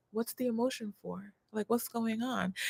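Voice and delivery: quietly imitating a confused boyfriend; imitating her man. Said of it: "he was just like".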